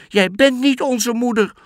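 put-on high, loud little kid voices; goat kids. Goat kids refusing the wolf, high insistent voices. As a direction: insist like scared small goats.

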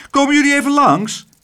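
hoge, luide roep; roepend. A narrator's reading. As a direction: roep vrolijk hard de telefoon in. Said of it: "riep mevrouw Cocklesmith door de telefoon". (luid en hoog roepen)

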